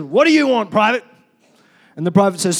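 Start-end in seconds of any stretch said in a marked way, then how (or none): 0.0-1.0 loud, raised bark of an officer; barked demand. Acted. Colonel turning on the waiting private, barking. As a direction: bark it at a subordinate. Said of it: "barks and says".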